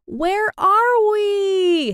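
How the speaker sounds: high drawn-out scream down the slide; long frightened scream, very high. A narrator's reading. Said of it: "screamed Sarah".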